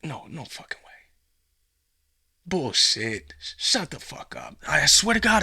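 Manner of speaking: whispered shock turning to a shout; stunned intruder swearing; stunned disbelief. Pretend. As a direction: gasp it out in disbelief.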